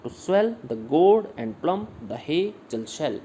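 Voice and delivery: slow, "swell", "gourd", "plump", "hazel" and "shell" each hit harder; scanned iambic stress. Shown as a demonstration. Teacher scans the poetry line for the students, slow and stressed. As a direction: read it out beat by beat, slowly. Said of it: "ये stressed हैं".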